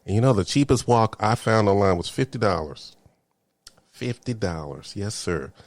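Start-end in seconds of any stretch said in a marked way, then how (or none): 3.9-5.5 softer, slow and dry; deadpan disbelief. Real, not acted. Vlogger telling viewers the wok costs fifty dollars, dry and soft. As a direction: let the price sink in flatly.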